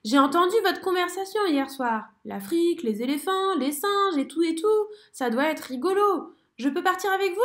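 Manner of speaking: voix de grenouille aiguë et enjouée. A narrator's reading. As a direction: demander avec entrain. Frog voice. (grenouille enjouée, voix aiguë)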